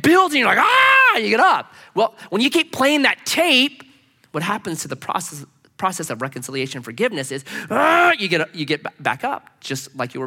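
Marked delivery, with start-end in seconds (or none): 0.6-1.2 high, loud yell of building rage; acted exasperated scream; angry yell. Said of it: "No, you get angrier". 7.7-8.1 high, loud growl of frustration; acted flare of rage; angry outburst. Acts anger flaring back up.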